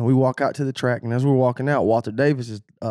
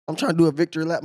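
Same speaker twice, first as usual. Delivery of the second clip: usual speaking voice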